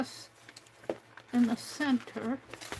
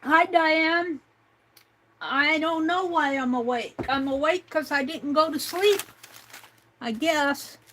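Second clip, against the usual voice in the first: loud, bright hello to Diane; cheerful greeting; 4 semitones higher, 6 dB louder than usual. Real, not acted. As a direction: call out happily across the room. Greets someone, louder.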